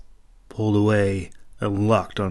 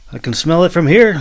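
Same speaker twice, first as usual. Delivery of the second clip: mischievous explanation; 8 semitones higher, 9 dB louder than usual. A narrator's reading. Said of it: "Leclove explained".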